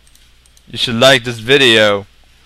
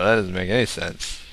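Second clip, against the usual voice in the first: usual speaking voice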